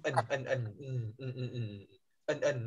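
"ẩn" repeated to isolate the closing N; final N sound. Shown as a demonstration. Teacher showing class the ending of bean, isolated N in "ẩn". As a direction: hum out the N at the end of "ẩn". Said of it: "có cái đằng sau là nà".

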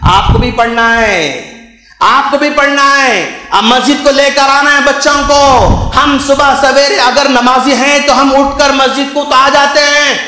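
impassioned preaching. Acted. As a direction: preach it forcefully to the congregation. Preaches at full force. (जोशीला उपदेश)

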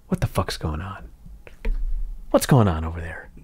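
low, quiet and unbothered, a hint of The Rock; mock calm. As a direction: shrug it off coolly and quietly. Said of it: "As calm as can be".

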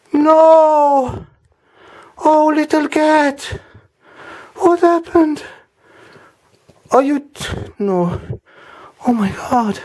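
high, slow, hammed-up lament over a pet; hammed-up grief. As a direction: overact heartbreak over a cat. Mock grief over the "dead" cat.

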